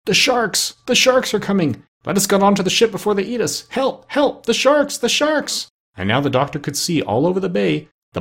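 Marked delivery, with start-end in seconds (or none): 0.1-5.7 high panicked pirate cries for help; frightened cries. A narrator's reading. Pirates in the water screaming at the sharks, high and panicked. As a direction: cry out in terror as the sharks close in. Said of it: "they all cried out in great fear".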